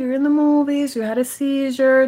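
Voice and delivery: gently orient someone coming round; acted-out soothing talk, unhurried; gentle retold reassurance. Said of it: "yo le dije".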